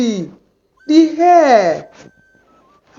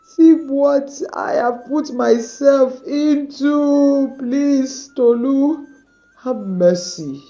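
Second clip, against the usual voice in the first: crying; 5 semitones higher than usual. Acted. Tayo cries in remorse.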